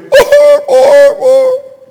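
high, loud yelping whine; imitated dog whine. The injured dog crying out, a high loud whine. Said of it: "my dog was going".

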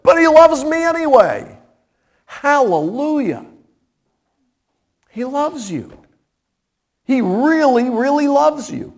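high, ringing pulpit cry, lifted up to the rafters; fervent preaching. Acted. Preacher urging the radio listeners toward grace, in a ringing pulpit cry. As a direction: preach it out to the whole congregation.

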